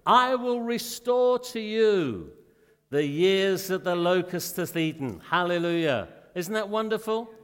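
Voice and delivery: loud, ringing sermon delivery; fervent pulpit preaching; full-force preaching. Acted. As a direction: preach it out to the congregation.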